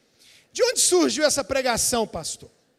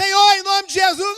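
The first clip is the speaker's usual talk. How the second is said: higher, shouted prayer; loud prayer; 7 semitones higher, 8 dB louder than usual. Retold or imitated. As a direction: pray out loud at full force. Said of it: "Ora alto, Josué". (oração em voz alta, gritando)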